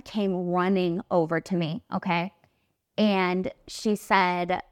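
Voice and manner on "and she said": ordinary talk, nothing marked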